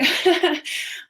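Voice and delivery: high, embarrassed laugh through tears; teary laugh. Real, not acted. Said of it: "I didn't expect myself to get emotional".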